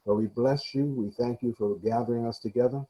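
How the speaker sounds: ordinary delivery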